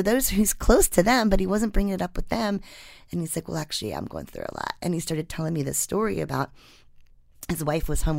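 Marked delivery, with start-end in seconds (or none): 3.5-4.7 low, soft admission; subdued retold confiding. Weatherman confiding in the reporter, subdued retold confiding. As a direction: lightly voice a man quietly opening up.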